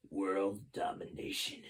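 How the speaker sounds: slow, soft sinister declaration; villain announcing conquest softly. Acted. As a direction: savour the evil plan.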